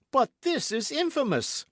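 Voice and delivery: high, loud outraged exclamation; indignant cry. A narrator's reading. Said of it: "cried he".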